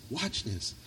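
a preacher hushing the church to listen close. Real, not acted. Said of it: "And listen".

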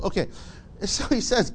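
ordinary talk

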